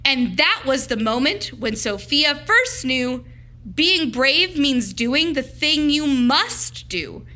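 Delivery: plain reading voice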